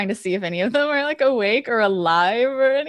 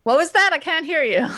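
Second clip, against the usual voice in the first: raised, high-pitched call; calling back; 7 semitones higher, 3 dB louder, faster than usual. Acted. Prisoner to a faint voice elsewhere, calling back. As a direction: call out as if straining to hear.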